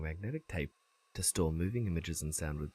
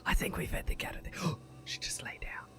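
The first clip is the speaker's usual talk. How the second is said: hushed whisper with a gasp; 5 dB quieter, faster than usual. Real, not acted. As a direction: whisper back, then gasp quietly.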